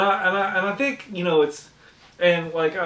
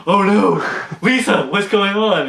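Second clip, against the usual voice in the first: high, loud hammed-up alarm; mock panic; 6 semitones higher, 7 dB louder than usual. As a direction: play a kid freaking out. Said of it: "starts going".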